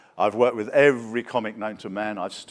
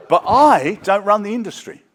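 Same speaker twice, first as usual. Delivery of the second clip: laughing through the line; amused, high and loud; 9 semitones higher, 9 dB louder than usual. Real, not acted. Laughing punchline.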